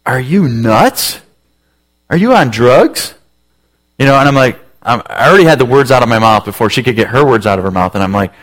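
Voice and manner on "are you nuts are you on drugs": slow, drawn-out disbelief; a wife hearing a crazy idea, slowly; mock incredulous wife. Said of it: "she was gonna be like".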